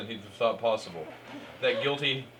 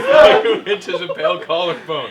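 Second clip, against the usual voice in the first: louder, laughing through the words; 5 semitones higher, 15 dB louder, slower than usual. Real, not acted. Narrator reading the racy fanfic line, laughing. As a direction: read it while cracking up at the line.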